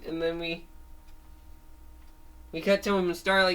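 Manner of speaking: plain reading voice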